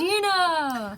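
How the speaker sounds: stretched, slow host flourish on "Kadena"; game show host voice. Retold or imitated. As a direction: drag the name out like a game show reveal. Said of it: "I realized that as I was saying it".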